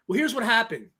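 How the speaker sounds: ordinary talk, nothing marked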